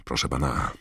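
ordinary delivery

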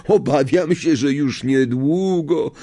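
high, falsely sorrowful sneer; mocking sadness. A narrator's reading. Malfoy predicts teacher's downfall, mockingly sad. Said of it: "z szyderczym smutkiem".

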